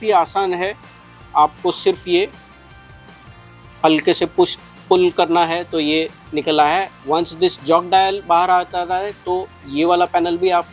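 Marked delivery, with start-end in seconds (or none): none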